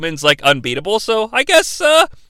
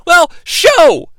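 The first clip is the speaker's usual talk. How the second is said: exasperated outburst; 6 semitones higher, 4 dB louder, slower than usual. Real, not acted. Exasperated rant about Brood Edge.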